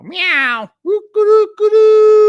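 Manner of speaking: high yowling meow, then a slow, shouted woo-ka-doo; hammed-up imitation of wild stage cries; mock show cries. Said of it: "what goes on in Uncle Edwin's garage when he's doing his show".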